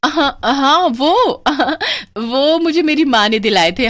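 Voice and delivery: high, flustered with a giggle; nervous laugh. Acted. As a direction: laugh off the lie. Caught lying, nervous laugh. (घबराई हँसी)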